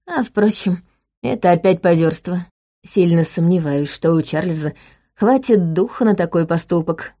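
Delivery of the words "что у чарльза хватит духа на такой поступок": usual speaking voice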